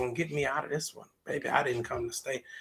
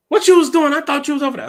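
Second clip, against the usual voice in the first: high, loud, incredulous demand; raised-voice acted interrogation; accusing questioner; 13 semitones higher, 13 dB louder, faster than usual. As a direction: act out a nosy challenger, much louder. Acted questioner confronting the worshiper.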